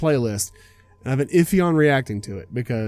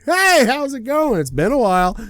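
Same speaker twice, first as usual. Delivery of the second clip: mock casual greeting; 10 semitones higher, 4 dB louder than usual. Planning a chance run-in with an old friend, faked cheery greeting. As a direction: feign a big, breezy surprise hello. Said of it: "just happen to show up ... and just say".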